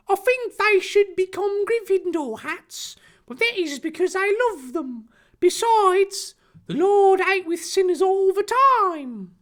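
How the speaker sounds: very high, hammed-up preachy cartoon voice; bold declaration. Harry defending the Slytherin hats, bold high put-on voice. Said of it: "Harry declared boldly".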